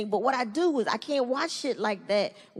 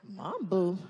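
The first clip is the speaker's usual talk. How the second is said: lower, softer disapproving tone; judging stare; 6 semitones lower, 4 dB quieter than usual. Retold or imitated. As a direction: say it like a disapproving onlooker. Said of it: "they're like".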